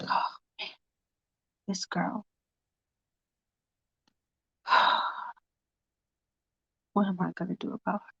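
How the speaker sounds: breathy frustrated whisper with a sigh; whispering to himself. Retold or imitated. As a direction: whisper it under your breath, fed up. Michael alone, frustrated, to himself.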